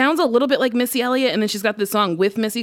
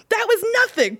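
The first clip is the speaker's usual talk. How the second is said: high mock gasp; 14 semitones higher than usual. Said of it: "And I was like".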